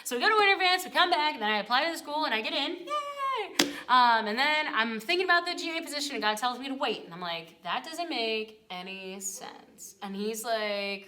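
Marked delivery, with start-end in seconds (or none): 2.9-3.7 very high little cheer; high-pitched mini celebration; celebrating. Retold or imitated. Celebrates getting into the school. 7.6-9.9 very slow, softer objection; baffled protest. Retold or imitated. Retold protest to God's instruction.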